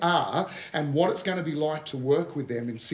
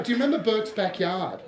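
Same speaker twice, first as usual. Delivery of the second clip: normal talking voice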